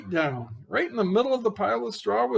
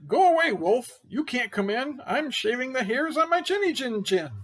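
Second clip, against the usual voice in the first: higher, louder yell from behind the door; pig yelling back; 7 semitones higher, 4 dB louder than usual. Acted. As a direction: shoo the wolf away, shouting. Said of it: "He yelled back".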